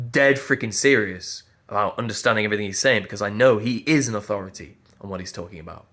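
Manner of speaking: normal talking voice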